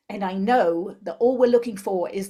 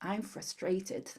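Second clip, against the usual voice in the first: much softer, drawn out and hushed; soft, slow tapping phrase; 8 dB quieter, much slower than usual. Shown as a demonstration. A therapist hushing the phrase while tapping under the nose. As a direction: let it out slowly and quietly. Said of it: "Next point is on the upper lip".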